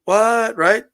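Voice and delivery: usual speaking voice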